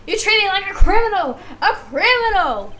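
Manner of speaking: loud, high indignant shouting; acted wail of outrage; outraged witness. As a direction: protest bitterly, stretching the key words slowly. Witness protests being accused.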